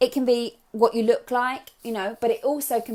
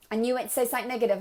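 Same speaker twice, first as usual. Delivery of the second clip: usual speaking voice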